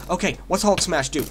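normal talking voice

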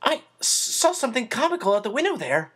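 put-on high, nervous, stumbling boy; whinnied wildly. Chris blurts an excuse to the woman, high whinny. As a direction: squeak it out in a panic. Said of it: "he whinnied wildly".

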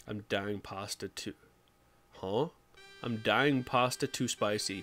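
ordinary talk, nothing marked

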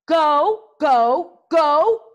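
repeated high, loud shouts climbing to a shriek; frantic scream. A narrator's reading. Hiccup flapping his arm frantically, screamed command. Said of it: "screamed Hiccup".

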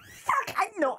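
ordinary talk, nothing marked

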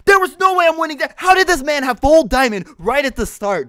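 high, loud outraged yelling; furious shout. Real, not acted. Gamer ambushed by a geared opponent, outraged shouting. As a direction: shout in disbelief at the unfair fight. Ambushed by full diamond player.

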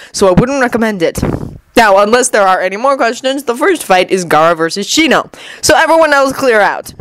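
Obito. A narrator's reading.

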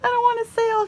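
high, put-on groan of dread; mock dismay. Dreading a sale, she mocks her own high groan. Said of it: "I'm like".

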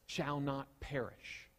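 usual speaking voice

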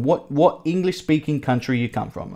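ordinary talk

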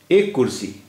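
ordinary talk

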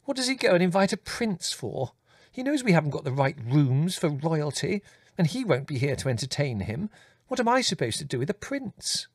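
high, flustered, cross mother; angry complaint. A narrator's reading. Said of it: "Mother exclaimed angrily".

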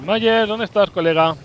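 loud call across the map. Real, not acted. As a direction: shout out for a friend. Calling out for a character.

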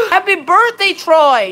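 surprised and thrilled for a viewer; excited birthday greeting. Real, not acted. Greeting a viewer on his birthday, excited. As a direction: gasp then a bright, loud happy birthday.

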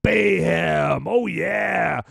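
high shouted rally announcer hype; monster truck ad. Acted. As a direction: yell it like a monster truck commercial.

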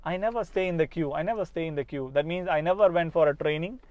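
ordinary talk

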